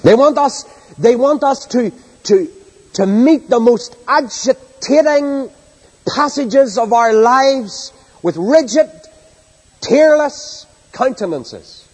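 high and slow, every word stretched; preaching at full force. Acted. Preacher scorning tearless faith to congregation, impassioned. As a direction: lift the voice and hammer the point.

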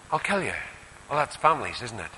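ordinary talk

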